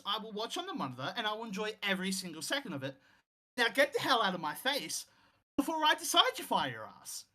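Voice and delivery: hammed-up threat, savouring the punishment; retold angry threat. Boss threatens employee with an ass kicking, mock-angry. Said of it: "And Callis is like".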